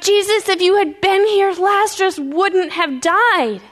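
high, drawn-out grieving plea; crying out. Acted. Mary crying out to Jesus over Lazarus, high and anguished. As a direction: cry out in reproach, stretching the last words. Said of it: "she cries out".

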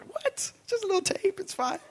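very high, soft, playful what, it's fine; mock innocent shrug. Brushing off friends about his taped-up car, mock innocent. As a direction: wave it off like it's nothing.

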